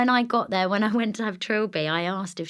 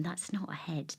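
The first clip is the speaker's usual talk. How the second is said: hushed whisper; whispered aside; 3 semitones lower, 12 dB quieter than usual. Retold or imitated. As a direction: murmur it so the patient won't hear. Overheard midwife murmuring to mum.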